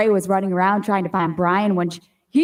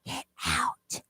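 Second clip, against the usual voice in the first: hushed, menacing whisper; very soft ghost-story warning; 12 dB quieter than usual. Acted. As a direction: whisper it like a creepy caller.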